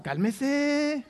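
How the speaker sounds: mock-soothing cálmese the way the street says it; street slang. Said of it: "como dicen las razas".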